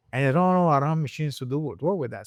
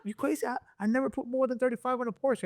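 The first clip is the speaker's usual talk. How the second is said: imitating a high, incredulous tuner; shocked refusal; 11 semitones higher, 4 dB quieter, faster than usual. Tuner balking at more boost on the Porsche, high incredulous voice. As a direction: squeak out the disbelief of a nervous tuner.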